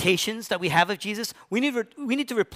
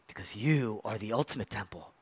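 hushed, soft; 8 semitones lower, 9 dB quieter than usual. Acted. Father voiced softly to Jesus.

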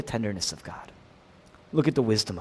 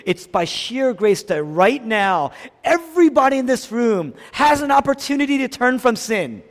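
high, loud, urgent appeal; forceful sermon climax; impassioned preaching; 10 semitones higher, 7 dB louder than usual. Real, not acted. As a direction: press the congregation with rising intensity.

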